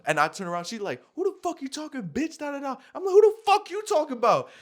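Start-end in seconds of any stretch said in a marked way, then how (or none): 1.2-2.8 very high-pitched mock outrage; imitated angry girl. Girl cursing him out, imitated high and indignant. 3.1-4.5 very high, loud yelled comeback; retold furious screaming. Him cursing back at the girl, re-enacted as a loud yell. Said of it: "I'm screaming at this bitch".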